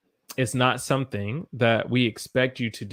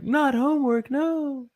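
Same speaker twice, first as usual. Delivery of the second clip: high mock whine of a kid refusing homework; mock student whine; 13 semitones higher than usual. Teacher imitating students protesting homework in a high mock whine. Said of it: "Biza and Rowan are like".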